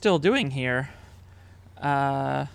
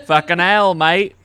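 laughing, louder mock Australian "fucking hell, mate"; imitating an Australianism; 8 dB louder than usual. Host laughingly imitates his co-host's over-the-top Australian accent. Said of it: "when you tried to say an Australianism".

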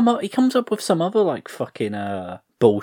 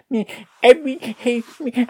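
mocking the actress; 14 semitones higher than usual. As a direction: squeak it out like a clueless actress rambling. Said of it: "and she's like".

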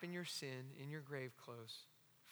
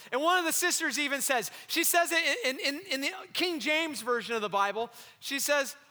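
ordinary delivery

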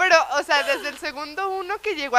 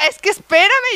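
high, loud imitated protest; mock pleading; 9 semitones higher, 13 dB louder than usual. Boyfriend asking her to wait for the ring, mock pleading. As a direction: imitate an exasperated plea for patience.

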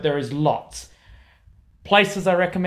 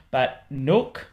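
ordinary delivery

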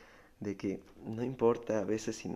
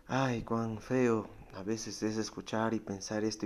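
normal talking voice

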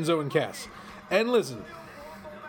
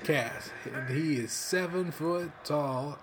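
very slow, softer promo voice; Enzo impression; 4 dB quieter, much slower than usual. Imitating Enzo introducing Big Cass. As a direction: stretch each word like a hype man. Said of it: "I'm about to do his whole shtick".

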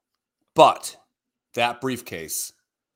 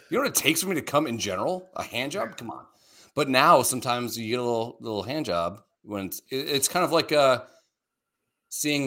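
normal talking voice

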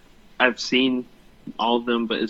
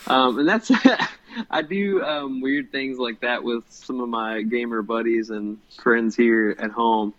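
normal talking voice

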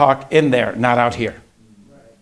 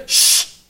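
long unvoiced hush; parent's silencing "shh"; sharp shush. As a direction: cut the bickering off with a shush.

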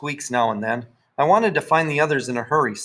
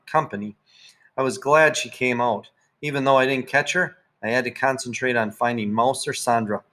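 ordinary delivery, nothing marked

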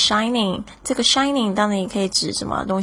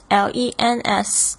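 slow spelling; slower than usual. Shown as a demonstration. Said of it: "就是镜头，lens".

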